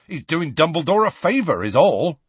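scathing. A narrator's reading. Hagrid defending Firenze to centaurs, scathing. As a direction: put them right with scorn. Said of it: "said Hagrid scathingly".

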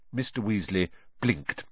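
usual speaking voice

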